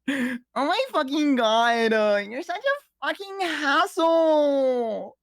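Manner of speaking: high, slow whiny put-on voice; high slow mock complaint; mock exasperated whine. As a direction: drag it out like a fed-up cartoon. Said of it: "the "you always fucking do this" thing".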